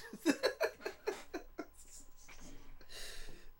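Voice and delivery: long high unvoiced giggle; high breathy laugh. Real, not acted. Vlogger cracking up at the word arse, high giggle. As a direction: burst out laughing at your own joke.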